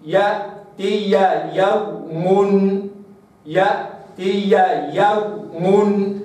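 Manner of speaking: يأتي يوم drawn out slowly; slow recitation. Shown as a demonstration. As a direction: hold each ya in يأتي يوم. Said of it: "هكذا". (يأتي يوم ببطء)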